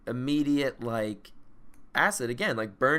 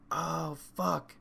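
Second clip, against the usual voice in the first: alarmed; 7 semitones higher, 5 dB louder, much slower than usual. Retold or imitated. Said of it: "and I'm like".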